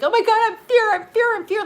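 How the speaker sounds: high, loud, frantic imitation of fearful people; mock panic. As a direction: mimic someone overcome by fear. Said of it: "when the fear comes in and people are like".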